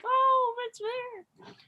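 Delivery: very high, puzzled squeak; mock puzzled. Imitating a baffled guy, squeaking high and puzzled. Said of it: "And you're like".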